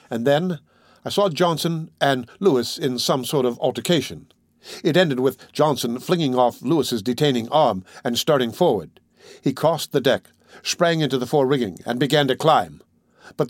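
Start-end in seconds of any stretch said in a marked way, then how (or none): none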